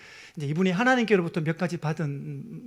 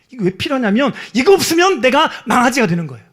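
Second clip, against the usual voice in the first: louder emphasis; 4 semitones higher, 8 dB louder than usual. Acted. Preacher repeats for emphasis.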